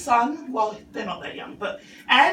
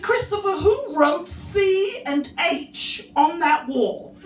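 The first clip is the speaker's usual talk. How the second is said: stern mum; 7 semitones higher, slower than usual. Retold or imitated. Mum interrogates son.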